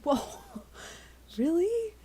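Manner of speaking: high loud surprised question. Real, not acted. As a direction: gasp it out in disbelief. Surprised by fact.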